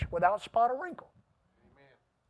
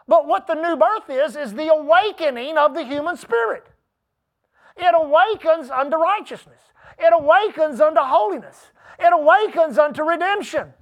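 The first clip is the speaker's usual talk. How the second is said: raised, emphatic preaching voice; impassioned preaching; 5 semitones higher, 7 dB louder than usual. Real, not acted. Preacher telling congregation of the awakened spirit, impassioned preaching. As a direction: proclaim it with rising fervor.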